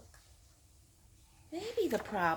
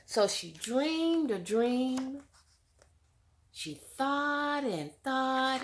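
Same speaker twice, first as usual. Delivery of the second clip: slow, higher, drawn-out retelling; dreamy storytelling; 3 semitones higher than usual. A narrator's reading. Teacher retelling the dream to the class, slow and higher. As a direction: savor each word for the children.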